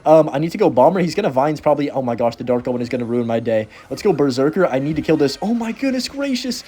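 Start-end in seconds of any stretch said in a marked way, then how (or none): 5.4-6.6 stunned exclamation. Real, not acted. Opponent floods the lane.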